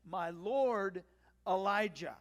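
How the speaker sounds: loud, reverent form of address; solemn deference, each part weighted; deferential. Acted. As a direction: hail him as your master, pausing before the name. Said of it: "the deference with which he greets Elijah".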